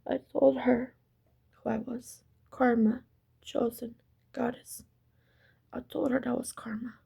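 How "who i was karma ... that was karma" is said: whispered. Real, not acted. Intimate revelation, told in a whisper.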